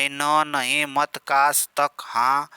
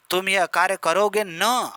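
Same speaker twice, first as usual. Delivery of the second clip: higher line with न pushed at the end; stress on न; 4 semitones higher than usual. Shown as a demonstration. Teacher demonstrating particle to class, न stressed. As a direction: press the final न like a demand. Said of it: "अब यहाँ न पे बल दिया जा रहा".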